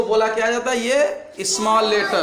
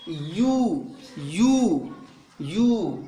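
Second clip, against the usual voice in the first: drawn-out letter; 4 semitones lower, 3 dB quieter, much slower than usual. Shown as a demonstration.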